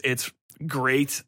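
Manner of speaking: ordinary talk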